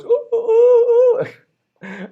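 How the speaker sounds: very high, slow, drawn-out dog howl; moaning dog impression. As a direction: whine like a sad moaning dog. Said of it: "this is my best impression of a dog".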